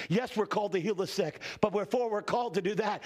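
ordinary talk, nothing marked

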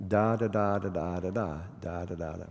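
chanting da da da to show the beat; da-da meter. Shown as a demonstration. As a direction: tap out the meter on each da. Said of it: "called meter".

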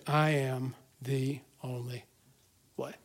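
low, soft and slow, weight on I; hushed quote. Acted. Quoting Jesus after long pause.

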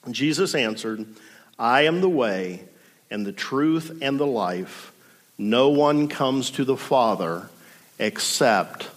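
measured, solemn Bible reading; scripture reading. Acted. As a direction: read the passage with reverent weight. Reads scripture passage aloud.

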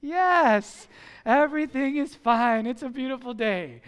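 loud, over-bright cover-up; two-faced cheer. Retold or imitated. Caught father assuring his neighbor all is fine, two-faced cheer. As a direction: burst out as if nothing happened. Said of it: "we talked about two-faced".

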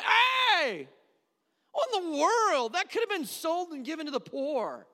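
high, loud mock shout; indignant outburst. Judas breaking into the weeping woman's worship, indignant shout. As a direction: cut in yelling as outraged Judas. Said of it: "and he's like".

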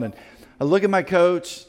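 usual speaking voice

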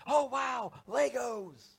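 loud, high-pitched gift-opening delight; excited kid. Acted. Little boy ripping into birthday gifts, excited. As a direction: gush like a thrilled little kid.

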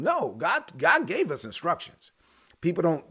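ordinary talk, nothing marked

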